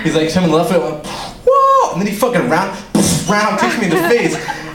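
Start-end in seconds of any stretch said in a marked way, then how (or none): 1.5-1.9 high-pitched comic "whoa"; mock startled cry. Comic retelling dad's sudden kick, mock startled cry. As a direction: yelp it high, as if dodging a kick.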